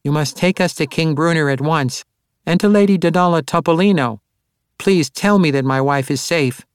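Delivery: high, pressing halfling voice; anxious halfling husband pleading; urgent halfling. A narrator's reading. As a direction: beg quickly for news of his wife. Halfling pleading for his wife.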